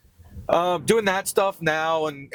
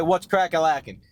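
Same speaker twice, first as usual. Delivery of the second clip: catchphrase; 6 semitones higher than usual. Shown as a demonstration. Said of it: "Let's make that our saying".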